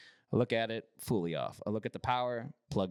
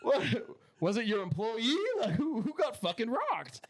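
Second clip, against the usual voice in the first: high incredulous mock voice; 8 semitones higher than usual. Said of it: "I was like".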